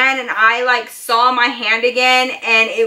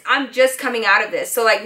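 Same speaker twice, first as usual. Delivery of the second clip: plain reading voice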